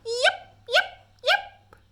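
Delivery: very high little fox yips; little fox calling out, very high; fox yipping. A narrator's reading. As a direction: yip high like a small fox pup. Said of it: "She perked up her ears".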